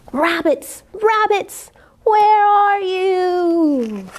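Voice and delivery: put-on hungry wolf boy howling into the night; drawn-out howl. A narrator's reading. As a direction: stretch the words into a long wolf howl. Wolf boy howls for rabbits.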